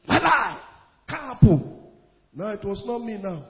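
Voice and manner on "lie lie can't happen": loud voice refusing death; bold retold rebuke. Preacher retelling his bold words at the deathbed, loud. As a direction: declare it with sudden authority. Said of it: "a man cannot talk like that".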